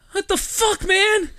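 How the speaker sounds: high, loud disbelief; alarmed outcry. Retold or imitated. Driver looking around on waking, alarmed high shout.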